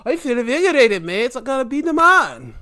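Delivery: loud, high-pitched triumphant quote; hammed-up burst of confidence; mock invigorated. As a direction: imitate her newly fired up. Acts reinvigorated Becky Lynch.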